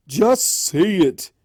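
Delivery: slow, loud, high demand; heckling crowd. Acted. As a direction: push her, impatient.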